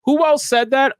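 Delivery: high, pointed mock question. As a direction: ask it like you already know the answer. Mock question before sarcastic answer.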